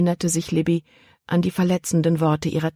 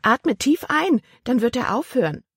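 a high, lightly irritated aunt; aunt irritated at the hiccups, mild; mildly annoyed; 8 semitones higher than usual. A narrator's reading. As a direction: snap the advice, mildly. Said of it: "einen ärgerlichen Blick zu".

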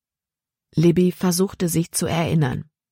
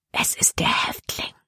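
hushed, voiceless whisper; 4 dB quieter than usual. A narrator's reading. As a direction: whisper it in secret to a companion. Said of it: "flüsterte sie Caleb zu". (geflüstert)

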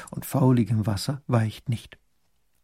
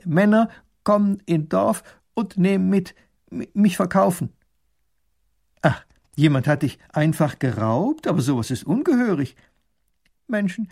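higher, halting learner's German; a foreigner struggling for words; broken German; 8 semitones higher, 4 dB louder than usual. A narrator's reading. Slave telling the duke his story in halting broken German. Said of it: "man hört, dass er diese Sprache nicht von Kindheit auf gelernt hat".

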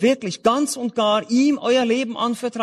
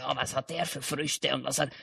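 low, much softer mimicry of a fault-finder; muttered nosy judging; 7 semitones lower, 11 dB quieter than usual. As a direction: mutter it under your breath like someone inspecting others. Mimics people inspecting others' fruit.

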